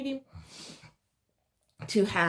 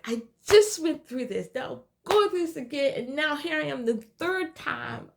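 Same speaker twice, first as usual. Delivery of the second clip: higher, louder, indignant complaint; angry outburst; 9 semitones higher, 3 dB louder than usual. Retold or imitated. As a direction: rail at the unfairness. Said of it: "I remember being so angry".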